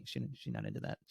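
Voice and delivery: normal talking voice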